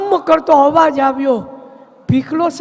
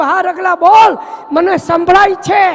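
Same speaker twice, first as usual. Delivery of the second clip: higher, louder reply shouted back to the hall; calling back loudly; 4 semitones higher, 6 dB louder than usual. Acted. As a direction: call out that you hear him fine. Bapu calls back across house. (હોલ સુધી મોટેથી જવાબ આપતા બાપુ)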